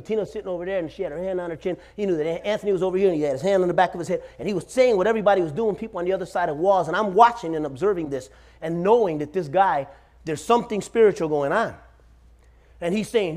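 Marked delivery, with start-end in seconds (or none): none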